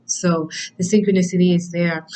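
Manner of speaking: usual speaking voice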